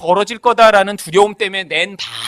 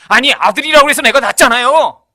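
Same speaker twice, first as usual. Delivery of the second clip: retold mother yelling, louder and higher; a mock furious protest at the fortune teller; angry mother confronting the fortune teller, loud; angry complaint; 4 semitones higher, 8 dB louder than usual. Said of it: "화를 내면서 소리를 지르는 거예요".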